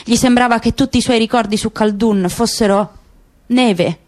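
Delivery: ordinary delivery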